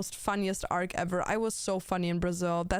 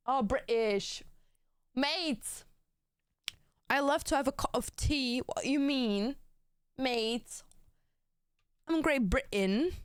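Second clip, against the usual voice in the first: hammed-up British accent, pitched up; mock British accent; 3 semitones higher, slower than usual. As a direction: make fun of Brits in a put-on British accent. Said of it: "I need to stop making fun of Brits".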